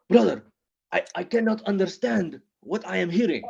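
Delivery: put-on high, emphatic accented English; Ahmed. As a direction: burst out with "brother," accented and indignant. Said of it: "like, a passionate friend".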